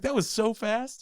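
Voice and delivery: high, loud, drawn-out exclamation; retold astonishment. Host retelling their reaction to the window closing, astonished. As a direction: exclaim it slowly, pitched right up.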